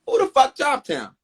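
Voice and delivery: high, indignant challenge to the panel; a hint of an outraged defender; indignant demand. Daddy Khan demanding answers from the panel, indignant. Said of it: "He gets up and says".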